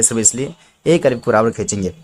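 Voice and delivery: ordinary delivery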